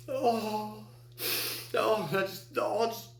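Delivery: high, choked-up voice close to tears; real tears breaking through the words; voice breaking with grief. Real, not acted. Retelling his father's story, voice breaking into tears.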